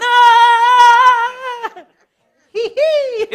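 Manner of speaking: very high, loud terrified shriek into giggle; panicked shriek. Retold or imitated. Passenger in a crashing plane shrieking, high and loud. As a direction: scream like the plane is plunging. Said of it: "Who's gonna be going ten thousand miles".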